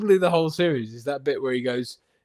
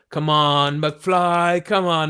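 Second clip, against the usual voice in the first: mock bully taunt; 4 semitones higher, 6 dB louder, much slower than usual. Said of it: "there's that bit where it's like".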